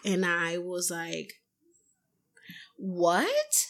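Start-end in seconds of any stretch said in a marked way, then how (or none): 2.8-3.7 a retold, rising "what?"; drawn up into disbelief; stunned disbelief. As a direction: question it as if you misheard. Acted shock at his question.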